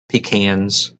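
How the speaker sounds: pecans said pee-KANS; pecans with long ee, short a; pee-CANS pronunciation. Shown as a demonstration. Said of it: "however it is".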